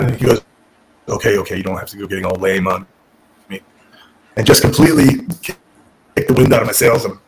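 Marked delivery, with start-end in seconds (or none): 1.1-3.6 low deadpan brush-off; Tommy Dreamer. Retold or imitated. Boss deflating a grateful new hire, monotone. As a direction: cut off gushing thanks in an imitated monotone. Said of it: "This super monotone voice".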